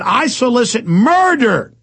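hammed-up impression of a rival host; mock quote. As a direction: parody him as a villain. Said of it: "He said".

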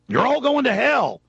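high, drawn-out mock condemnation; mock damnation. Spammer's repeated damnation post acted out.

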